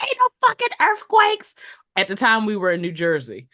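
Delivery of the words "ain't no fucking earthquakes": high-pitched mock outrage; imitated cussing out. Retelling coworker's denial of the quake, high mock outrage. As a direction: play the indignant coworker, pitched way up. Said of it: "she cussed me out so bad".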